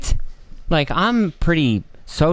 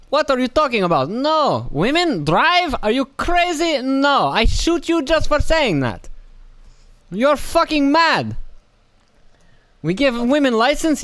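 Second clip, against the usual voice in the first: much higher, hammed-up caricature of a chauvinist foreigner; mock foreign hardliner; 10 semitones higher than usual. Mocking how other countries treat women, high caricature voice. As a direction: sneer it out like a bigoted strongman, pitched way up. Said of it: "Most other countries, it's like".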